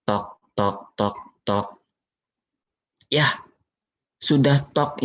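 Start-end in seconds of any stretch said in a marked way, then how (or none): 0.0-1.9 soft knocking sounds. Shown as a demonstration. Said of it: "diketok lagi".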